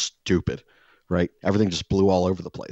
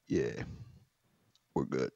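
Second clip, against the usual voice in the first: low laid-back neighbor; 7 semitones lower, 10 dB quieter than usual. Retold or imitated. Neighbor's terse reply.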